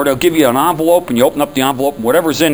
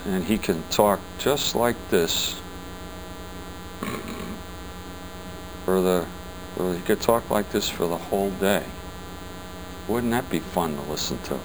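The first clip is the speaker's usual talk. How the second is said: Tom Floyd's monotone; 7 semitones lower, 11 dB quieter than usual. Retold or imitated. Mocking a calm colleague who never gets excited, low soft drone. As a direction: drone on low and quiet like a man with no energy. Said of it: "he kind of talks like this".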